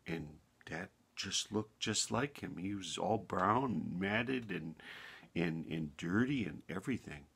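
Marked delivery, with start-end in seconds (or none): none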